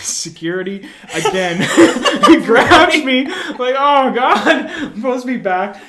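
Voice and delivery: high, loud, cracking up; words bursting out with laughs; laughing while telling. Real, not acted. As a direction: tell it through helpless laughter. Laughing through retold security grab.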